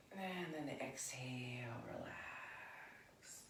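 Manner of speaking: soft whisper, slow; whispered yoga instruction; whispered exhale cue. Shown as a demonstration. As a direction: breathe the words out in a whisper.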